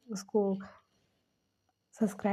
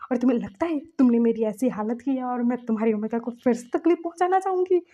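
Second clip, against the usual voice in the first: higher and louder, speeding up at the end; frantic plea; 3 semitones higher, 7 dB louder than usual. Acted. Myamya begs Lajan to check the footage: high, loud, frantic plea. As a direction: beg him urgently, rising to a cry.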